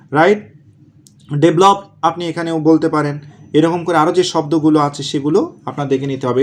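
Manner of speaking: ordinary talk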